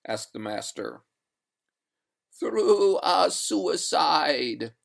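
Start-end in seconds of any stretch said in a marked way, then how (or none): 2.4-4.7 high, very slow spectral wail; eerie, long-drawn high ghost; doleful water ghost. Acted. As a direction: stretch every word like a mournful spectre. The ghost answers the master.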